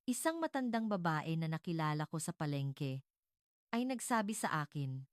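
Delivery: plain reading voice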